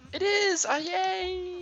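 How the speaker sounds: high, delighted squeal; thrilled to find it; excited. Real, not acted. Finds cabana, delighted.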